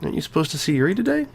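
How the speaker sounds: put-on high, girlish and teasing; Sayori's voice. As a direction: pitch up into a bubbly schoolgirl.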